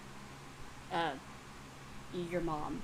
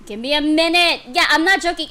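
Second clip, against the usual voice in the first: loud and high, worked up; worked-up exclamation; 5 semitones higher, 12 dB louder, faster than usual. Real, not acted. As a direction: burst out loudly, overwhelmed. Loud reaction to the comic.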